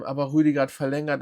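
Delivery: ordinary delivery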